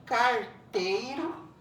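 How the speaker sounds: "carteiro" slow, broken after car; split into syllables. Shown as a demonstration. Teacher recapping three-syllable words, "carteiro" slowly split. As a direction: draw out "carteiro" with a gap inside. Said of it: "trissílaba".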